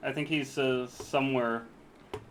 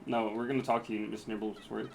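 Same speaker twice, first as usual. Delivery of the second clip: normal talking voice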